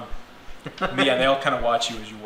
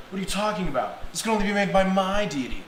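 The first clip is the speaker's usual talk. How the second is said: higher, indignant retort; acted squabbling cleric, pitched up; indignant rival cleric; 8 semitones higher, 3 dB louder than usual. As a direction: argue back for your own god. Rival cleric argues back.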